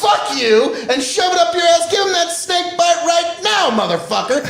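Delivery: over-the-top shouted outrage; mock furious shout. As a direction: bellow the demand for the drink. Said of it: "I was like".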